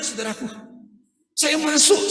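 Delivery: ordinary talk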